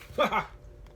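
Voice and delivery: quick amused laugh; a brief laugh at the crash; short laugh. Real, not acted. After drum crash.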